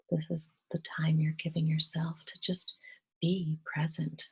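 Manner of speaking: soft, hushed meditation voice with long pauses; quiet, unhurried breath-led calm; guided meditation. Real, not acted. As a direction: gently guide them into relaxation, leaving space to breathe.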